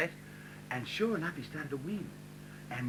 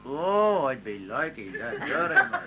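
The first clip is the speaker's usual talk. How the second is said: mock Indian accent; 4 dB louder, slower than usual. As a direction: play the Indian girl, sweetly and politely, in an Indian accent. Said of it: "beautiful Indian girl".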